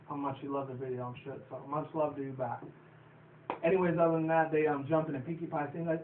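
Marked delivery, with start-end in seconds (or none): none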